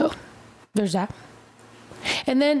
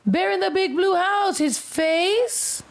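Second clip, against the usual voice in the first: excited; 7 semitones higher, slower than usual. Real, not acted. Excited about childhood show.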